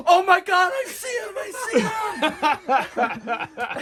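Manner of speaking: on the phone with Dave, seeing the friend, shouting with relief; excited shout. Retold or imitated. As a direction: shout it like you just spotted him. Spotting lost friend on road.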